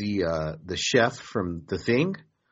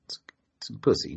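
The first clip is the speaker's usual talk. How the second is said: whisper; faster than usual. Real, not acted. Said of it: "unvoiced whisper aside".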